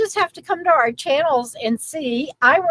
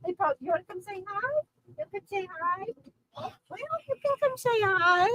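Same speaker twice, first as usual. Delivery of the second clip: soft, higher sing-song call to the dog; coaxing pet talk; 7 semitones higher, 9 dB quieter, faster than usual. Real, not acted. Coaxing her dog to come say hi, soft sing-song. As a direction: coax the dog over gently.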